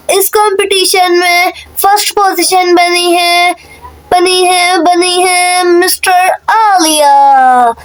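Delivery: slow, suspenseful announcer call; winner announcement. Acted. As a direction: build suspense before the name.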